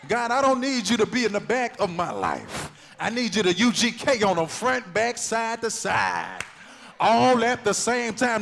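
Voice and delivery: loud, rising preached prayer to God; fervent prayer. Acted. Preacher crying out a prayer to God, loud and fervent. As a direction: cry it out to God with full force.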